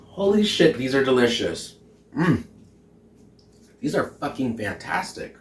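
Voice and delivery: ordinary delivery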